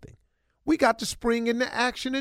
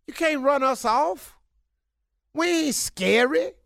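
higher, coaxing reassurance; high pleading; 7 semitones higher than usual. Real, not acted. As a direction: coax the women, voice up. Pleading for men.

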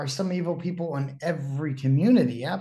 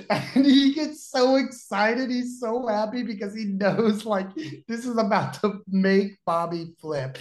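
normal talking voice

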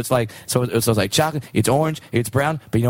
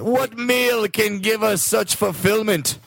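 a pompous king announcing his feast; grand singsong king; dwarf king; 6 semitones higher than usual. Acted. Dwarf king's courtly speech, sung.

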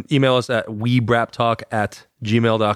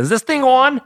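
put-on voice; 4 dB louder than usual. Mock testing old answering machine.

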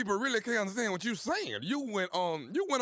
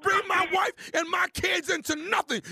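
furious shout; 10 semitones higher than usual. Pretend. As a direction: yell at him to leave your family out of it. Prank caller shouting about family.

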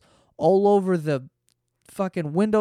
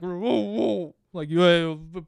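dog talking back; slower than usual. Retold or imitated. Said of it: "curse me out under his breath".

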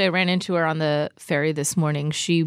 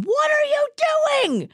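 high-pitched catchphrase scream; exasperated scream, pitched way up; mock scream. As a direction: screech it like a pull-string doll. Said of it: "yeah, I scream".

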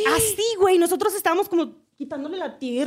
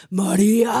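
a lower, louder man's voice coming out of a woman; 5 semitones lower, 4 dB louder, slower than usual. Retold or imitated. A stranger calls the widow's name in a man's voice. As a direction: call the name like a man speaking through her. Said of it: "empezó a hacer una voz de vato".

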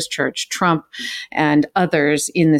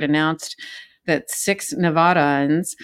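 normal talking voice

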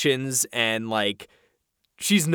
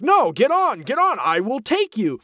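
insistent driver; 9 semitones higher than usual. Retold or imitated. Driver insisting Obi-Wan get on, high pushy voice. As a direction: press them to get on, pitched way up. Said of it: "he's like".

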